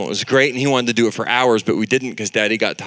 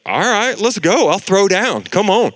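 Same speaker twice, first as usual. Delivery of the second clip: hyped-up dad; 8 semitones higher, 4 dB louder than usual. Retold or imitated. Dad hyping up wrestling.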